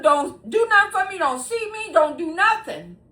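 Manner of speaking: high, loud mimic of the bossy government lady; mocking the lady. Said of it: "She told them".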